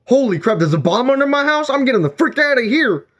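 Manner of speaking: high, frantic panic; imagined panic. Pretend. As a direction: freak out at the bomb news. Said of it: "I mean, like".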